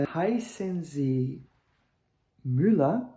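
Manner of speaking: Heißen Sie Müller dito devagar, com Müller subindo no fim; pergunta direta, subindo. Shown as a demonstration. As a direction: pergunta sim ou não bem marcada. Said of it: "primeiro eu vou fazer de forma direta". (entonação de pergunta direta em alemão)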